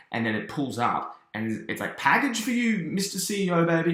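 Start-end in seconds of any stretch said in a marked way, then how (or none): 2.0-3.9 high put-on cartoon delivery voice; delivery caller. Delivery man announces parcel to CEO Baby, high-pitched call.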